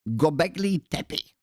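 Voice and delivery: hammed-up German accent, slow and high; slow, emphatic German pronunciation. Shown as a demonstration. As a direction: read it out in your best mock German. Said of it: "That's my best German accent".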